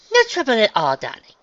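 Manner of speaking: high, airy start, softening on the last word; gracious refined unicorn. Acted. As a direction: wave off thanks like a society lady.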